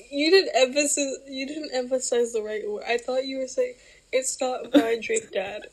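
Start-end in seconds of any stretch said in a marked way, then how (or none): none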